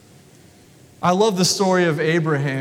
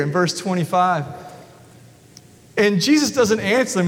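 usual speaking voice

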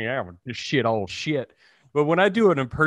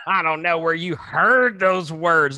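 higher, slow scolding mom voice; mom impression; 4 semitones higher, 3 dB louder than usual. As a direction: play a disapproving mom, drawing words out. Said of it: "and she's like".

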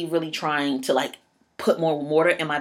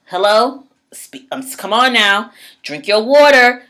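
louder, higher exasperated demand; exasperated shout; 5 semitones higher, 9 dB louder than usual. Real, not acted. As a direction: come on now, loud and fed up. Exasperated loud urging.

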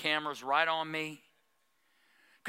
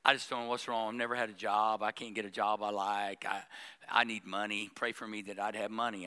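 lower, softer pleading whine; mock whiny churchgoer; 8 semitones lower, 5 dB quieter than usual. Pastor mimics a churchgoer asking for money prayers, low and whiny. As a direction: sound helpless and a bit pitiful, lightly mocked. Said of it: "People come and say".

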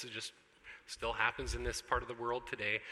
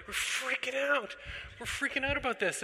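higher, hammed-up fretting; mock freaking out; 10 semitones higher than usual. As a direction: play the scared parent a bit longer. Mock panic continues.

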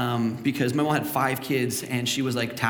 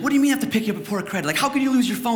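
higher, fast mock exasperated mother; exasperated mom; 9 semitones higher, much faster than usual. Mother scolding son about lost phone, fast mock exasperation. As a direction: rattle it off like an annoyed mom.

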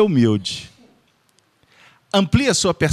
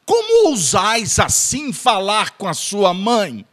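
slow, theatrical, archaic scolding; hammed-up indignant reproach; mock lofty rebuke; 5 semitones higher, slower than usual. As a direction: play an offended noble demanding respect.

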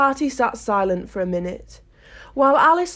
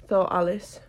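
ordinary delivery

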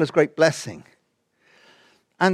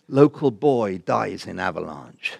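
slow, low newspaper headline; solemn headline reading; 8 semitones lower, slower than usual. Retold or imitated. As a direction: read it out slowly as grim news. Imagined newspaper headline read out.

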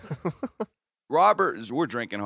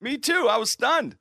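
much higher, stunned and indignant; stunned outrage; 13 semitones higher, 6 dB louder than usual. Real, not acted. Telling hosts about the loss, high indignant. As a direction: still reeling from the shock.

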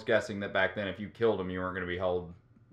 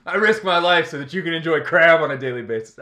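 loud, laughing mock fisherman; crab fisherman voice; 6 semitones higher, 10 dB louder than usual. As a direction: play the proud hero fisherman.

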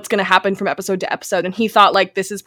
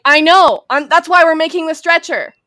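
louder, higher retort; mock exasperation; 6 semitones higher, 5 dB louder than usual. Podcaster voicing Michael's comeback to Anna, mock exasperated.

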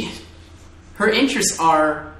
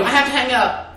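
mock hasty phone voice; 8 semitones higher than usual. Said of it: "It's just like".

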